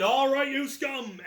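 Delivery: loud, slow high captain's shout; captain hollering across a toy store at his crew; pirate captain bellow. Retold or imitated.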